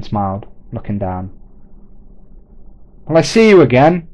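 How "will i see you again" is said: loud, high hopeful call. Acted. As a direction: shout it after him down the road. Simon calling across the street.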